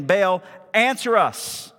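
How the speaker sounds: stretched, pleading invocation; calling out to a god. Acted. Acts the prophets calling on Baal.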